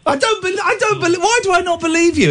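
high-pitched, incredulous protest; disbelieving. Real, not acted. Host doubting a caller's Spice Girls story, disbelieving.

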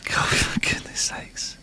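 muttered under breath; whispering, slow and fed up; whispered exasperation. Real, not acted. Exasperated aside.

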